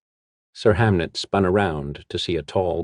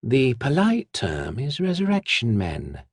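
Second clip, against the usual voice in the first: correcting whisperer; 6 semitones higher than usual. Acted. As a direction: primly correct him in her whispery voice. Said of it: "the whisperer corrected".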